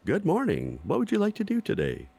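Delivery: high, robotic talking appliance voice; old speech synthesizer. Pretend. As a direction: sound like a chirpy talking stove. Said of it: "It comes up and says".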